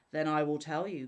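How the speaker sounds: loud, menacing cry; the spirit loudly threatening the scholar. A narrator's reading. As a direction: call it down on him loudly. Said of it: "cried the spirit".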